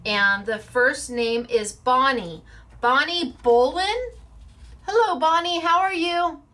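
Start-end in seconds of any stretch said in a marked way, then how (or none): 2.8-6.4 high, loud sing-song calling out; cheery pretend phone call to a winner; mock phone greeting. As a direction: call her up like she's on the line.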